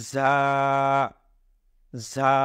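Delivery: "ঝা" as a long, level "zaa"; drawn out. Shown as a demonstration. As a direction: take a long time over each "ঝা". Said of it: "লম্বা সময় নিয়ে করব".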